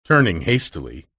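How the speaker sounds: plain reading voice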